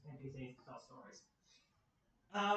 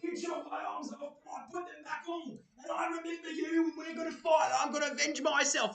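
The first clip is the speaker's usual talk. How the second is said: high, loud shouting orc voice; hammed-up orc bellowing a grudge; vengeful orc; 10 semitones higher, 8 dB louder than usual. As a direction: yell your revenge threat as the scarred orc.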